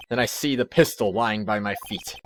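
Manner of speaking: ordinary talk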